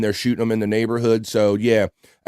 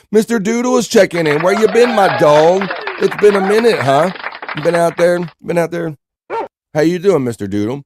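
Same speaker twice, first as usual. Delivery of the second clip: excited greeting; 6 semitones higher, 3 dB louder than usual. Real, not acted. Greets returning chatter Mister Doodle.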